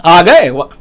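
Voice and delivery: mock-sulky wife voice; wife snapping at a late husband; annoyed wife. Sulking wife greets late husband.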